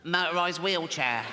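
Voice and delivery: put-on a lighter version of the strained child's voice; the voice again, a little lighter. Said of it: "I wouldn't do the voice".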